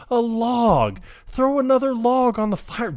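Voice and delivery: high mock colonel voice; high-pitched imitation of the colonel; colonel quote. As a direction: squeak it out as the colonel. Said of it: "And he's like".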